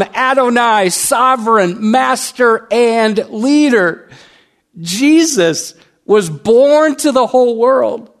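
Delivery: high, loud, emphatic sermon climax; full-force preaching. Real, not acted. Preacher proclaims Christ's titles to the congregation, full-force preaching. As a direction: proclaim each title, slow and ringing.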